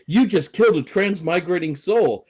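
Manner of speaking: mock-aggrieved quote with a lilt on each name; movie line. Reciting a movie line about a transmigrating soul, mock indignant. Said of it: "but the line is".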